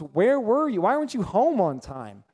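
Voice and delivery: acted marital nagging; nagging spouse. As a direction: play an annoyed spouse demanding answers. Acted nagging spouse after years married.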